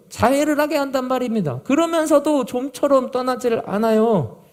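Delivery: acting a desperate father, high and loud; loud, upset pleading. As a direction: cry out the complaint as the angry father would. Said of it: "아버지가 막 큰 소리로 얘기하고".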